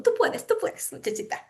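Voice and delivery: whispered, high grandmother's cheer; grandma's encouragement. Retold or imitated. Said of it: "mi abuelita siempre me decía". (susurro agudo de abuelita que anima)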